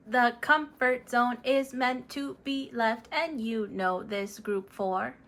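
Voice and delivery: flat, even robot monotone greeting friends; Mister Robot. Acted. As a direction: speak like a polite robot, no tune. Mister Robot continues the reading.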